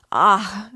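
high, loud cry of terror; sudden loud gasping "ah" of fright; terrified cry. A narrator's reading. Sonia hearing police are after him, crying out in terror. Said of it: "Sonia cried out in terror".

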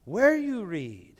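imitating old man. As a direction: voice a frail elderly man, gently and slowly. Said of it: "He goes".

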